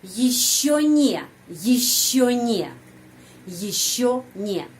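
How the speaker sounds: slow, careful Russian model phrase; slow demo. Shown as a demonstration. Teacher modelling slowly for students. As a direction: spell it out slowly for the learner. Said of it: "Dan kata belum".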